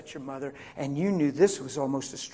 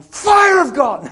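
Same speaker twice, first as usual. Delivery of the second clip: loud, slow preacher bellow, higher; mock fire-and-brimstone shout; 11 semitones higher, 14 dB louder, slower than usual. Preacher jokingly bellows a prayer over the sick coworker. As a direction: blast it like a faith healer, joking. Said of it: "So I came over real gentle".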